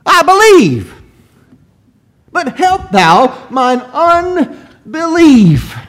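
high, loud, drawn-out plea; tearful crying out. Acted. Father begging the Lord for help, crying out in anguish. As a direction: cry it out as a desperate father begging. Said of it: "This man cried out, and in his tears".

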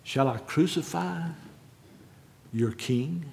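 soft, halting question with long pauses; grave, hushed question. Retold or imitated. Preacher reads Pilate's question to the mob, quietly. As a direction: ask it quietly, letting each phrase hang. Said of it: "not in the same way that I said it".

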